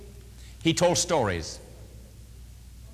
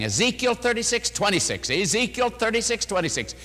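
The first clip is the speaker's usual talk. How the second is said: "Ezekiel thirty-six twenty-six" repeated with weight, raised; emphasizing the reference; 6 semitones higher than usual. Retold or imitated. Preacher drilling the reference into the couple, emphatic. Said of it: "I kept, uh, emphasizing".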